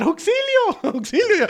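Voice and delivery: high, loud hammed-up "¡Auxilio!" with a laugh; mock cry for help. Retelling Rose screaming for help in the movie, hammed-up shout. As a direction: yell "¡Auxilio!" like a fake damsel, cracking up. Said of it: "porque empieza a gritar".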